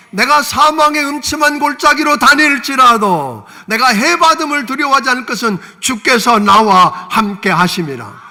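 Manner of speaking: slow, loud, fervent recital; loud declamation. Acted. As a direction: declaim the psalm with full force. Preacher declaims David's confession loudly. (다윗의 고백을 크게 외치듯)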